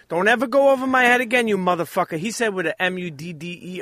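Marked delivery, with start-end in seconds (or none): 0.1-2.2 a light Joe Pesci menace, a bit higher and louder at the start; a hint of the Casino gangster; Joe Pesci impression. Friend quoting a movie gangster, light menacing impression. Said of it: "Joe Pesci in Casino".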